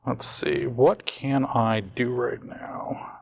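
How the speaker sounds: slow whisper to himself; thinking aloud under breath. Real, not acted. Muttering to himself over cards.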